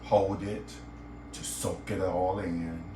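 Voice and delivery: guided meditation. Acted.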